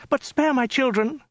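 high pleading woman's voice; frightened plea. A narrator's reading. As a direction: beg for mercy, high. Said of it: "stammered the woman".

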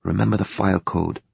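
soft muttering under the breath; quiet muttered aside. Acted. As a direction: mutter it to yourself while reading. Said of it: "she muttered".

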